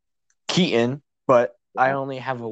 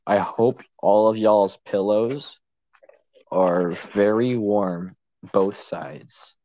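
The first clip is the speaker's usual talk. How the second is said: slow mock curse; slower than usual.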